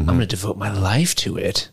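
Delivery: put-on voice of a devoted follower; Vecna impression. Podcast host playing Vecna pledging to the spider, mock-devoted. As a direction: pledge yourself in a mock-solemn character voice. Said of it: "Vecna would probably get up there and be like".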